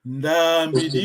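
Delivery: NDAMBIDI with a long, slow NDAA; long vowel. Shown as a demonstration. Teacher giving a student the model to try, long vowel. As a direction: hold the A of Ndambidi, then let it fall. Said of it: "la voyelle longue".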